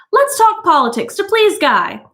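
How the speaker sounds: high, chirpy suggestion; lightly voiced eager hostess. A narrator's reading. Mildred proposing a topic to please her husband, chirpy. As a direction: perk up for the guests.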